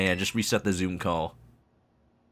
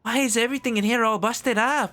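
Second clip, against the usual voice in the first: put-on high young character voice; 13 semitones higher than usual. Kid asking the professor.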